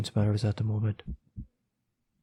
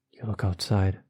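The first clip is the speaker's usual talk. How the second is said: ordinary delivery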